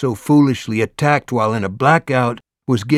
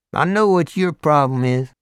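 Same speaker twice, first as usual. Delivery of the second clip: big Southern biker voice; Deep South accent; 6 semitones higher, 4 dB louder than usual. A narrator's reading. Hell's Angel asking Morris, loud Deep South accent. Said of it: "Deep South accent".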